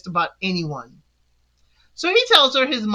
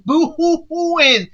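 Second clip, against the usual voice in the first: higher, drawn-out boo-hoo; mock crying; 6 semitones higher, much slower than usual. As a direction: imitate crying, slow and hammed up. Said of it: "she walks in crying".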